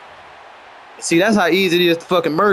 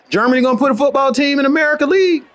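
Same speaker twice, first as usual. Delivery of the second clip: ranting shout; 6 semitones higher, faster than usual. Real, not acted. Ranting about Germany and football.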